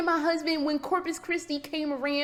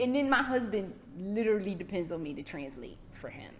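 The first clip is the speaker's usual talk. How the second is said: normal talking voice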